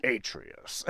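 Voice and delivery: lightly voiced stern warrior father; husky, half-voiced call to a son; Kratos. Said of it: "a Kratos voice".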